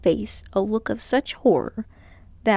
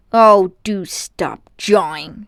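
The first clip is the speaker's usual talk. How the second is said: slow, louder fed-up drawl; boy cutting off his rambling uncle, loud and slow; exasperated boy; 7 dB louder, slower than usual. A narrator's reading. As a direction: roll your eyes at him.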